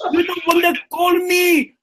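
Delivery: shouted, slow and high; mock outrage. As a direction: yell it down the phone in fake fury. Angry prank call shouting.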